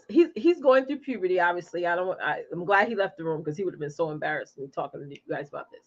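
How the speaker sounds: ordinary delivery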